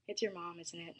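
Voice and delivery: understated, sighed remark trailing down softly; mild sigh. A narrator's reading. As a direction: say it with a touch of a sigh, letting the end drop away. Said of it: "she sighed".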